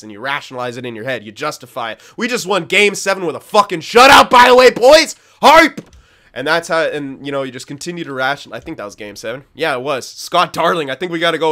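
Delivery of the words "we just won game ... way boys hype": much higher and louder, yelling in triumph; hype shout. Real, not acted. Celebrating a hockey win.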